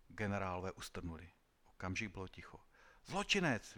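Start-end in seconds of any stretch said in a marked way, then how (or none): 3.1-3.8 high, loud cry of outrage; death-brigade commander crying out at the prisoner; general's outcry. A narrator's reading. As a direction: spit out the accusation. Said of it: "vykřikl velitel brigád smrti".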